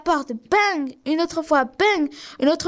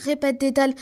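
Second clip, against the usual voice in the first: ordinary delivery, nothing marked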